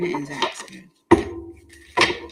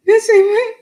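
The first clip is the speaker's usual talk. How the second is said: surprised; 13 dB louder than usual. Real, not acted. Startled reply.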